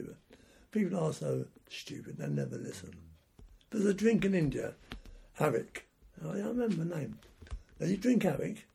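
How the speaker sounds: ordinary delivery, nothing marked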